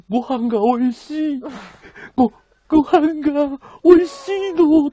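high, loud, tearful imitation of a sobbing girl; crying girlfriend. Streamer imitating his girlfriend crying over dinner, high and tearful. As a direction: play her bursting into tears over dinner, voice up high and loud. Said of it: "とか言って泣き始めて".

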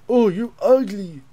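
slow, loud disgusted drawl at the monster; grossed-out yell; disgusted outburst. Real, not acted. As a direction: recoil in disgust, stretching it out.

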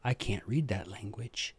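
a confessional whisper; whispered aside. Real, not acted. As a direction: drop to a whisper for the admission. Aside admitting he can't read it.

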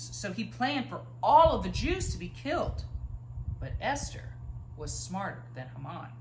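usual speaking voice

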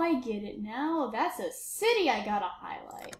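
usual speaking voice

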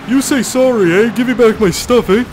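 high, loud mock-Canadian demand; imitated Canadian confrontation. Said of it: "this Canadian biker... he was like".

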